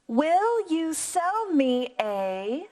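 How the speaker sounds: slow, rising question voice; rising question tune. Shown as a demonstration. Teacher modelling a question for the class, slow rising tune. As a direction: turn the voice up at the end. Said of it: "you need to inflect your voice".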